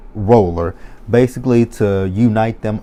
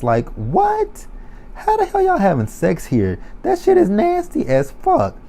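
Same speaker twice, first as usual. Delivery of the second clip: climbing to a high shriek; disgusted shriek; 12 semitones higher than usual. Real, not acted. Viewer shrieking in disgust at the wedding. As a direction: let the pitch rise into disgust.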